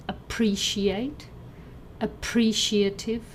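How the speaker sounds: "appreciate, appreciative", both stressed on the second syllable; unchanged stress. Shown as a demonstration. Teacher shows related words, second-syllable stress on "appreciate, appreciative". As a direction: lean on the "pre" of "appreciate" and "appreciative". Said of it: "the stress didn't change".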